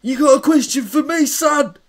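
high, loud, cocky geezer calling out; Schwartz. Pretend. Schwartz takes Barry's question, high loud swagger. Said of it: "Can I ask a question for Schwartz?".

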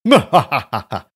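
loud hearty laugh; big laugh. Acted. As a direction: laugh out boldly. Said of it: "let out a big laugh".

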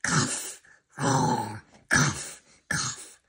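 coughing while trying to purr; failed purr coughing. Acted. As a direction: sputter and cough mid-purr. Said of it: "I cannot purr with my mouth closed".

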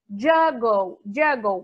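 "Juggle" said loudly and clearly; English word model. Shown as a demonstration. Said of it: "que ele tá fazendo o quê". ("Juggle" bem pronunciado)

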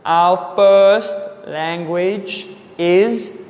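usual speaking voice

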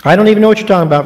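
rushed dismissive Peter; fast brush-off. Acted. Peter brushing off the servant girl, fast and dismissive. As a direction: toss it off quickly, like Peter dodging. Peter's first denial acted out.

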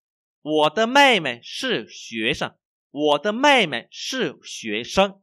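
very slow, loud, word by word; language teacher's model reading. Shown as a demonstration. Teacher reads for learners to repeat, slow model reading.

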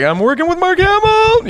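giddy high squeal, loud; mock fangirl squeal, stretched and high; starstruck. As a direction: squeal it like a fan who can't believe it. Retold inner squeal of a fan.

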